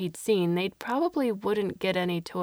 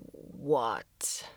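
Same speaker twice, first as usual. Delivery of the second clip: alien voice; 7 semitones lower, 4 dB quieter than usual. Acted. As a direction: lower demanding alien. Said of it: "the large alien towering over him demanded".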